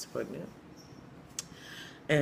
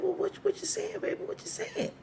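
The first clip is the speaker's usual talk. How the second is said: very high, cracked squeak; cracked-voiced church mother. Church mother pressing for the confession, very high cracked voice. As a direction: squeak it out as the puzzled church elder. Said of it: "she has a really cracked voice".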